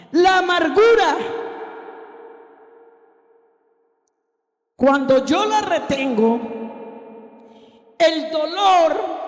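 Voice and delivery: high, ringing preacher's cry; emphatic preaching. Real, not acted. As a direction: proclaim each phrase, with long pauses. Preaches key point emphatically.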